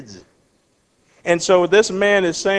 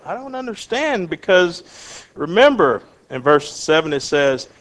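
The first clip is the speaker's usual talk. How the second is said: ordinary talk, nothing marked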